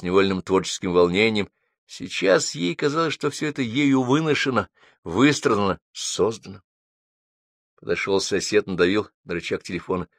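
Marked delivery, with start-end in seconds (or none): none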